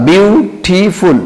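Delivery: বিউটিফুল very slowly in three stretched beats, বিউ–টি–ফুল; syllable by syllable. Shown as a demonstration. Teacher showing students many syllables, বিউটিফুল slowly split up. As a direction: draw out and separate each syllable of বিউটিফুল. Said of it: "syllable আলাদা".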